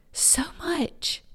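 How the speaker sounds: whispered, choked up with real feeling; tearful hushed whisper; emotional whisper. Real, not acted. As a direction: let the words fall to a whisper as emotion takes over. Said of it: "I'm getting a little emotional".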